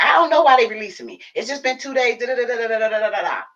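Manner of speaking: doing mom's fussy voice on the phone; imitating mom. Daughter voicing her fussing mom on the phone, high and fast.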